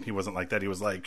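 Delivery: usual speaking voice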